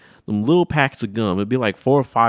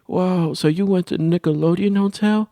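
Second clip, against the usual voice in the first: higher, impressed friend; 10 semitones higher than usual. Retold or imitated. Jared reacting to the slime, high. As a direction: sound impressed.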